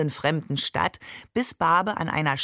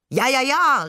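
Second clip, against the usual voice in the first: louder, high-pitched cry of agreement; called out; 7 semitones higher, 5 dB louder than usual. A narrator's reading. Shakir joins in his brother's act, calling out. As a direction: chime in loudly and high. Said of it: "rief Shakir".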